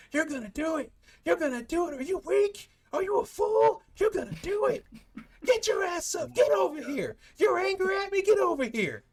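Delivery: high, strained rehab coach tirade; bully him into it like a hard-driving coach; yelling coach. Acted. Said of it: "like, yelling at him".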